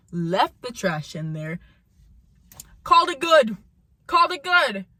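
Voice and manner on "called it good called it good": loud, high-pitched exclamation; incredulous outrage. Real, not acted. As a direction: punch it out in exasperated disbelief. Incredulous repeat about coworker's laziness.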